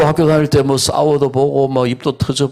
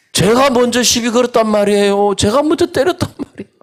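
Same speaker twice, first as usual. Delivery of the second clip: defiant son; 11 semitones higher than usual. Retold or imitated. Son's defiant reply voiced.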